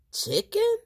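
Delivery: very high, startled rising question; surprised question. A narrator's reading. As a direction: sound amazed. Boy surprised by chicken.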